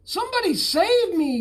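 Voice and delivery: very high, loud desperate yell; shouting for help. A narrator's reading. Stranded bullfrog shouting for help, high desperate yell. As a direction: scream for rescue over the storm. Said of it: "he shouted".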